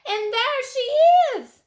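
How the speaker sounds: high loud excited cry; baby bear's cry. A narrator's reading. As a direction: shout it out, thrilled to find her. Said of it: "At Baby Bear's cry".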